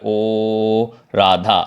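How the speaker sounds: very long steady "ओ", then "Radha"; long drawn-out vowel. Shown as a demonstration. Teacher demonstrates stretching the vowel when calling Radha, drawn out. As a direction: sustain "ओ" on an even pitch before "Radha". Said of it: "लंबा खींच रहा है".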